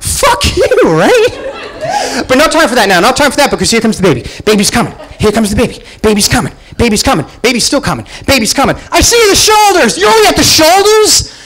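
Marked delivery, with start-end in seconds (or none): 0.0-2.2 high cracking mock outburst; mock outrage. Husband snapping back at the doctor, high mock outrage. 8.9-11.3 high loud alarmed cry; panicked shout. Retold or imitated. Father in the delivery room, high panicked shout.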